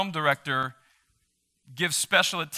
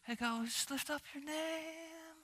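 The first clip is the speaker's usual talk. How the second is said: much softer, higher, slow half-hearted mumble; mock a limp, solemn worship; timid mock prayer; 12 semitones higher, 16 dB quieter, slower than usual. Pastor mocking weak worship, soft timid prayer. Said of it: "it's not".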